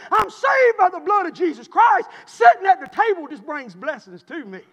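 fired-up pulpit shouting; full-force preaching. Real, not acted. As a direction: drive it home to the congregation, loudly.

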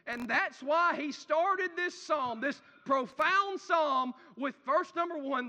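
emphatic preaching. Acted. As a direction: lean into each phrase slowly. Preacher slows and swells.

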